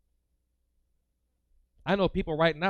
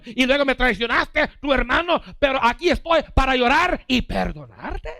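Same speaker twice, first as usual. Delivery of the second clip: loud, higher, driving delivery; preaching at full force; 8 semitones higher, 8 dB louder than usual. Acted. Preacher retelling Esau's forgiveness, loud and high. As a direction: preach it out to the room.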